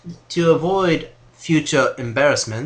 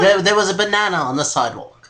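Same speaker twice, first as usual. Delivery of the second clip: flustered excuse; 6 semitones higher, 4 dB louder, faster than usual. Acted. Flustered lie continues.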